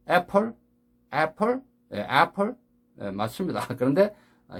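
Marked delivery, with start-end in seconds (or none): 0.1-2.6 애플 as e-peul with clear final l, higher pitch; learners' Korean-style apple. Shown as a demonstration. Teacher echoing learners, Koreanized apple. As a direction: lift 애플 in two Korean syllables. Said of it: "어떻게 해요".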